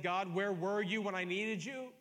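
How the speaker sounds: loud, high pleading questions; anguished cry to God. Acted. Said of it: "when you said".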